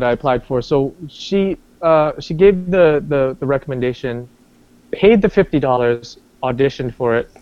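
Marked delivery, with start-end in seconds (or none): none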